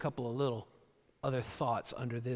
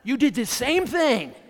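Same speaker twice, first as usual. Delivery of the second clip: accusing spouse; 8 semitones higher, 10 dB louder than usual. Retold or imitated. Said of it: "You know".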